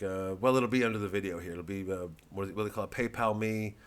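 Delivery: usual speaking voice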